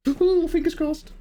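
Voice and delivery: very high, drawn-out mock coo; slow singsong falsetto; playful squeal. Streamer teasing a chatter who could be the first, high cooing. Jokey high reply after tease.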